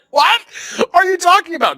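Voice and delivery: high-pitched comic disbelief; play-acted bewildered outburst, very high; mock incredulity. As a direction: squeal it in baffled astonishment. Acts out disbelief at the bracelets.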